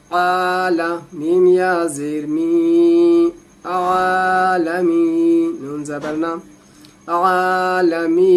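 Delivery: आला and आलमीन with the vowels drawn out slowly; long vowel stretched. Shown as a demonstration. Teacher showing the long vowel by stretching it. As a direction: pull the आ of आलमीन for one alif. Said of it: "उसको आप खींचेंगे थोड़ा सा".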